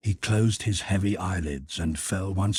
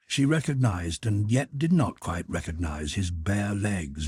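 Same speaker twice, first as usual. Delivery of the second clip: ordinary talk, nothing marked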